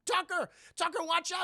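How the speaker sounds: high-pitched shouted alert; hammed-up alarm call; mock urgent warning. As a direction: warn a friend of danger behind. Voices Jackie's warning.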